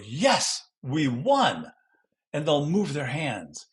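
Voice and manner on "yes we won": emphatic, higher-pitched cheer; excited student demo. Acted. Student showing the class an emphatic gesture, excited. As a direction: punch out each word like a victory cry. Voices student saying victory phrase.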